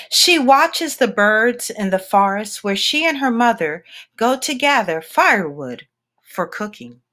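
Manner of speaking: ordinary delivery, nothing marked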